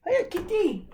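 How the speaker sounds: very high pet-talk voice; talking to the cat. Real, not acted. Teacher asks his cat Paige what she wants, high pet coo.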